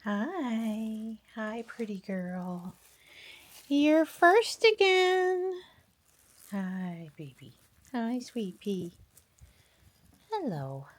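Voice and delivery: high, soft, slow cooing. Real, not acted. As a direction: coo sweetly at a pet. Greeting pets one by one.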